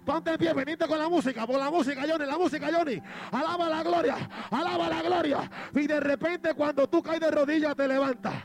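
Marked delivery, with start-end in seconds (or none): 0.0-5.5 fiery preaching. Acted. Preacher rousing the congregation to rise, fiery preaching. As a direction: rouse the church to stand.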